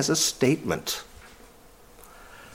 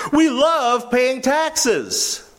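sarcastic mock claim; 7 semitones higher, much slower than usual. Mocking the accusers' claim.

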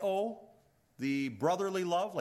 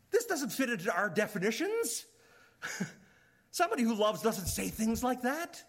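lightly acted, higher-pitched objection; mock incredulous; 5 semitones higher than usual. Preacher voices baffled listeners objecting to Jesus, mildly incredulous.

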